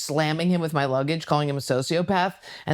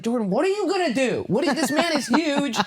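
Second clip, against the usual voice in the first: high-pitched exasperated friend; alarmed friend; 8 semitones higher than usual. Retold or imitated. Friend pleading with her before a fight, alarmed. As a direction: protest in a raised pitch.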